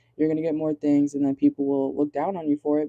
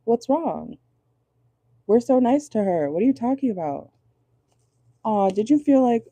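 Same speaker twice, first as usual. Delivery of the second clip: mock sweet, pitched-up innocence; fake innocent nice; 6 semitones higher than usual. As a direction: play the fake-nice coworker, lightly. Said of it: "then act like".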